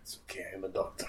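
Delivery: quick whispered aside; breath-only mock reassurance; hushed joking reassurance. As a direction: whisper it fast and soft. Joking aside after the shooting.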